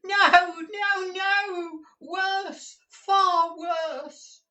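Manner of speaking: put-on very high old man's wailing; distraught miser crying out his loss; anguished wail. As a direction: wail it out in despair, far above normal pitch. Gride wails at his loss.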